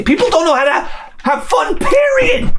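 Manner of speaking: high exasperated shout; exasperated rant. Real, not acted. Exasperated rant about joyless people.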